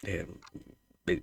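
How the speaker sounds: ordinary delivery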